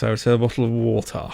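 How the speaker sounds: quick, put-on posh British a bottle of water, said to impress; posh British accent, bottle of water said the fancy way; hammed-up posh British accent. Shown as a demonstration. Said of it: "really trying to impress".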